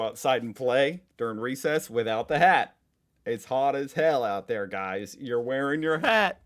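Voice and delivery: put-on loud, rising call to the kids; mock stern order. Adult telling kids at recess to wear hats, mock stern order. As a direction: lay down the rule loudly, pitch climbing at the end. Voices school rule to the kids.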